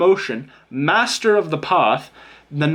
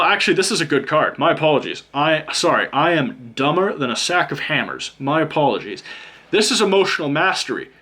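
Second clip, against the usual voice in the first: ordinary delivery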